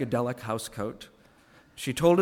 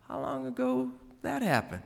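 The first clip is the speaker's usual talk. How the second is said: Saint Peter asks; 11 semitones higher, 4 dB quieter than usual. Acted.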